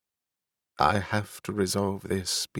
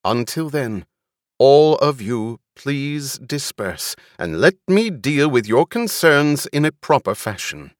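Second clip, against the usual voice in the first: loud, higher-pitched public address; commanding speech pitched up over a crowd; raised voice; 5 semitones higher, 6 dB louder than usual. A narrator's reading. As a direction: address a crowd with stern authority. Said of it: "She raised her voice".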